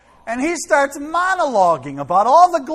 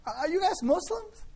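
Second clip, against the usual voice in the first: cheerful, much higher and rising; very happy tone; 9 semitones higher, 7 dB quieter than usual. Shown as a demonstration. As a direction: beam as you ask it. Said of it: "Said in a very happy tone".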